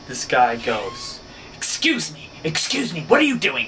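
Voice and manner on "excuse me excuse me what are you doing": mock stranger, much higher and nasal-free, pitched way up; high imitation of a subway stranger; imitating high-pitched voice. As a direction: imitate a stranger's high, piping call for attention. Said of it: "He's got, like, this really high-pitched voice".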